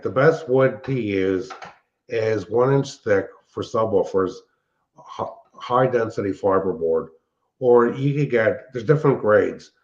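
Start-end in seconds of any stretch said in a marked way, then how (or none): none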